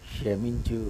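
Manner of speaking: breathy whisper of the pleading blood; barely voiced, pleading whisper; hushed plea. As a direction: whisper క్షమించు as a soft plea.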